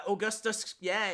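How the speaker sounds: ordinary delivery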